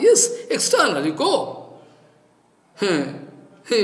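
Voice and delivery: ordinary talk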